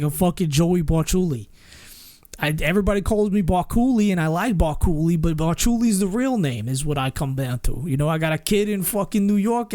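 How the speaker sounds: brash New York wiseguy patter; New York Joey accent. Shown as a demonstration. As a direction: rattle it off like a New York tough guy. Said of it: "from going from that to".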